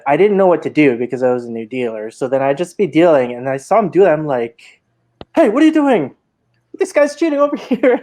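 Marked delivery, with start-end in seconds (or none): 5.4-8.0 yelled call-out. Retold or imitated. New dealer calling out a cheater, high yell. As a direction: blurt it out like a panicked new dealer. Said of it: "don't yell it".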